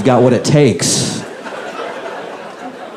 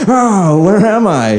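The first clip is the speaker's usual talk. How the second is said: put-on higher-pitched befuddled old president; Biden impression; 8 semitones higher, slower than usual. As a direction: act lost and bewildered. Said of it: "You're just like".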